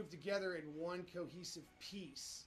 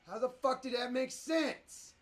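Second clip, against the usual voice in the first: higher, much louder and slow; outraged disbelief; 7 semitones higher, 11 dB louder than usual. Real, not acted. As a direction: yell each word in disbelief. Disbelief at the program.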